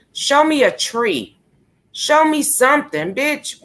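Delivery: high mocking taunt. Real, not acted. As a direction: goad them, sarcastic. Taunting the absent streamer.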